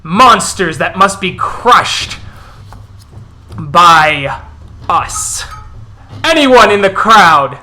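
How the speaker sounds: loud, fierce rabble-rousing; impassioned war-leader, hard bursts and pauses; wolf elder denouncing. Acted. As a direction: shout the accusation to a whole crowd. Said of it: "as the Elder goes".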